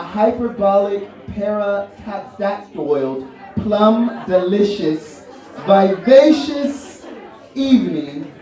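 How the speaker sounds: slow drawn-out words. Acted. Savoring fancy words slowly.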